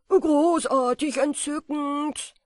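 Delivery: loud, rapturous cry of delight; jubilant. A narrator's reading. Moth dancing through the attic, loud and jubilant. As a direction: shout out your joy at flying. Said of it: "antwortete Flock".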